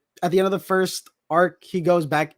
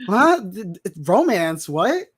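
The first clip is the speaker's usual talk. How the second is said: higher, louder stammered double-take; startled disbelief; 3 semitones higher, 5 dB louder, slower than usual. Acted. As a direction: act out being baffled, stumbling on the words. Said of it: "So you're like".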